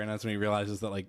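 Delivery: usual speaking voice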